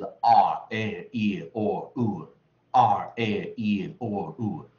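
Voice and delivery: slow, stretched R A E O U, each sound falling; American diphthong demo. Shown as a demonstration. Teacher listing American r diphthongs, slow falling R A E O U. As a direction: chant R A E O U one drawn-out vowel at a time. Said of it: "如果讲美式双元音".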